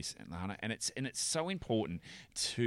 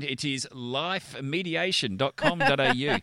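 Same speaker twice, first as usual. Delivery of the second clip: slow, louder spelled-out address; teasing, slow careful repeat of the website; slow and clear; 4 semitones higher, 7 dB louder, slower than usual. Shown as a demonstration. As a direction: spell it out slowly for listeners. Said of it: "if you didn't understand that Dutch accent".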